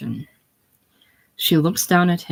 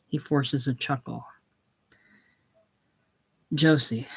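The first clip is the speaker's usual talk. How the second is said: ordinary talk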